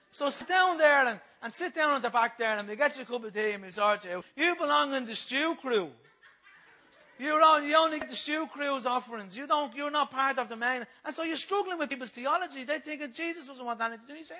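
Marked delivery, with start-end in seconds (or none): 0.1-10.8 loud, higher, brushing someone off; mock dismissal. Church people sending a newcomer to the back, loud dismissal. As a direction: put them firmly in their place, hammed-up.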